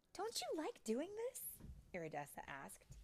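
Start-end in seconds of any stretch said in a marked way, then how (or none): 0.1-1.6 very high, sweet voice; questioning fairy friend. Acted. Iridessa asking Tink about tinkering, high and sweet. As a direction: ask in a high little fairy voice.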